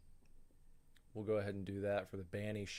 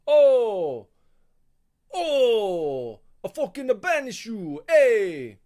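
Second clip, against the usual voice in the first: loud, high mock Italian yell; hammed-up Italian accent, yelling; Italian banishing; 11 semitones higher, 14 dB louder, slower than usual. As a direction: banish someone like an angry cartoon Italian. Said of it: "I know I'm not an Italian guy".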